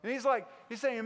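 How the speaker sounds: normal talking voice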